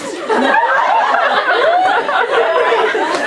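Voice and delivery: high, long giggle fit; can't stop laughing; laughter breaking up the read. Real, not acted. Long laugh mid-reading.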